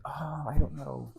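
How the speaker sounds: imitating a hesitant fiancée; reluctant hesitation. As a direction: drag it out, unsure. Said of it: "just like that she goes".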